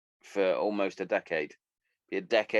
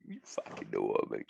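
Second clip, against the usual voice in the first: much lower, softer put-on voice; mock low voice; 11 semitones lower, 6 dB quieter than usual. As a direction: drop the voice way down for a mock repeat. Repeats catchphrase in put-on voices.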